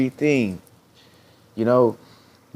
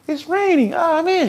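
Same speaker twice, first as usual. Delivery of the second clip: much higher, giddy delight at the rain; excitedly happy. Retold or imitated. Talking to herself about the rain, excitedly happy. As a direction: squeal it like a kid who loves rain. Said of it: "I'm so excitedly happy".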